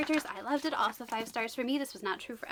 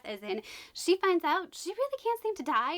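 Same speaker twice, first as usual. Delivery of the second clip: ordinary talk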